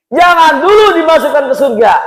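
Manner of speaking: high, forceful objection; hammed-up indignant plea to God; acted loud protest. Wife objecting to husband entering heaven.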